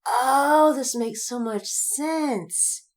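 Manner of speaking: slow, drawn-out realization; dawning realization. Retold or imitated. Writer realizing the unicorn is a lady, slow dawning wonder. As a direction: let it dawn slowly. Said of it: "And I was like".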